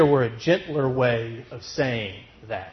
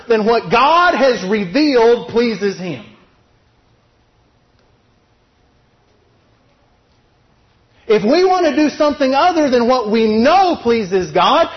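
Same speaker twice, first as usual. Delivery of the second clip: loud, high, driving sermon voice; fiery preaching; 8 semitones higher, 5 dB louder than usual. Acted. Preacher drives his point home to the church, fired up. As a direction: preach it hard to the congregation.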